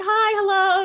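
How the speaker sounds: high-pitched, super-friendly greeting; mock an overly friendly neighbor saying hello; chirpy high hello, imitated. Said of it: "they're super friendly now".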